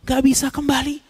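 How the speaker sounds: rising, desperate plea to God; pleading dead. Acted. The dead begging God to return them, desperate plea. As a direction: cry out to be sent back. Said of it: "Mereka bilang".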